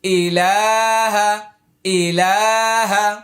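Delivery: slow chanted recitation with stretched vowels. Shown as a demonstration. Teacher reciting slowly while students hold a mirror. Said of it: "আবার আয়না ধরুন".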